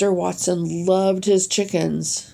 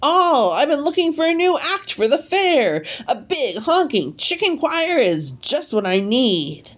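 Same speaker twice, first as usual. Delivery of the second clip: 1 eager official lady; 7 semitones higher than usual. A narrator's reading.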